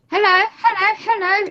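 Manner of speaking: high posh "hello hello". Retold or imitated. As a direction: mimic a posh caller. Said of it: "in his posh voice".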